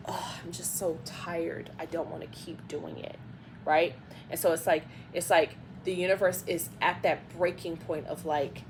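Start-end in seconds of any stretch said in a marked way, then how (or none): 0.1-3.2 a tired, defeated groan of a line; weary sigh. Acted. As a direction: sigh it out, exhausted and fed up. Voicing a worn-out person.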